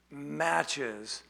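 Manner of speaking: usual speaking voice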